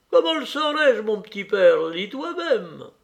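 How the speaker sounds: voix de vieille femme plus aiguë, un peu lente; la servante Matrena annonce une lettre. Acted. (Matrena parle à son maître, voix de vieille servante)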